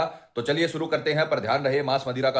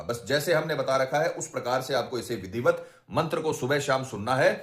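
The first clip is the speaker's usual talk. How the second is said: usual speaking voice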